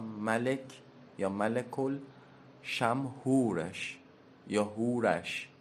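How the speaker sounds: ملک یا ملک الشمهورش یا هورش drawn out word by word; teacher spells out ملک الشمهورش at dictation pace; slow repeat pronunciation. Shown as a demonstration.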